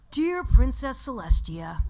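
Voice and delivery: loud, slow cartoon boy voice; Spike. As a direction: declaim it as a boy dictating a letter. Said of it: "for Spike".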